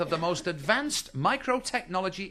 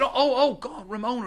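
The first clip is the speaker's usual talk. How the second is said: caught out; 9 semitones higher, 4 dB louder than usual. Acted. Husband caught out by wife.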